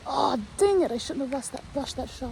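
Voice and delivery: loud, annoyed groan at herself; frustrated outburst. Real, not acted. Hunter scolding herself after a rushed shot, loud and frustrated.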